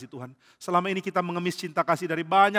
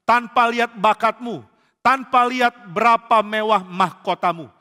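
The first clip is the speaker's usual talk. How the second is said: preaching at full force; 5 semitones higher, 8 dB louder than usual. Real, not acted. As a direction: drive each phrase home from the pulpit. Khotbah memuncak. (khotbah berapi-api, lambat dan keras)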